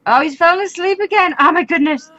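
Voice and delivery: high, loud cry of disbelief; dismayed gamer's outburst; exasperated dismay. Real, not acted. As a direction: wail in frustration at the setback. Character falls asleep again.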